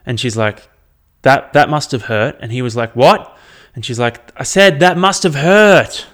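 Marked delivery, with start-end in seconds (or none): 1.2-2.3 louder repeat. Retold or imitated. Receptionist repeating to deaf man. 2.9-3.4 high, loud rising what; loud confused what. Retold or imitated. Deaf man failing to hear. 4.3-6.1 high, loud, slow shouting of every word; shouted repeat. Retold or imitated. Receptionist finally shouting at deaf man.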